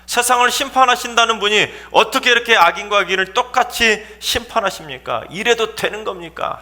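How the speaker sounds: high, heated challenge to God; agitated protest. Acted. Abraham disputing God's unfair judgment, agitated high-pitched protest. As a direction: argue your case in agitation. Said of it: "아브라함이 막 흥분해서 하나님께 막 따진".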